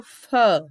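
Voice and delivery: "fer" stretched and pushed out; stressed syllable. Shown as a demonstration. Teacher isolating the strongest syllable, stressed fer. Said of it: "A sílaba mais forte dele é o".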